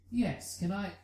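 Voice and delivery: plain reading voice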